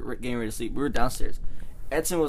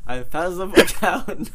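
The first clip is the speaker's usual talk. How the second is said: laughing, higher and louder; laughing through it; 6 semitones higher, 5 dB louder, slower than usual. Retold or imitated. Retelling how he slept through the food, laughing. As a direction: crack up on the last words.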